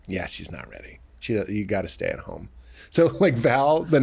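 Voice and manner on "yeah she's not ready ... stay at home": much softer, lower, matter-of-fact; quietly dismissing over the phone; doula over the phone. Retold or imitated. Doula on the phone telling the husband to stay home, much softer. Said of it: "the doula would be like".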